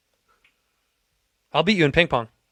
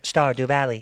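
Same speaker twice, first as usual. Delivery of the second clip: flat mechanical robot voice; slower than usual. Acted. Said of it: "That was robotic".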